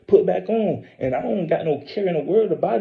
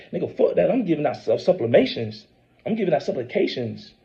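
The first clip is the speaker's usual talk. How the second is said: normal talking voice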